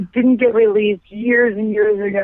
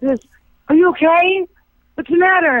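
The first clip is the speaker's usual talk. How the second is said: worried director; 7 semitones higher than usual. Retold or imitated. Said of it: "And the director goes".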